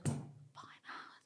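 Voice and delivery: unvoiced, incredulous whisper; shocked whisper. Hearing the five hour wait, a shocked whisper. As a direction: gasp it out under your breath.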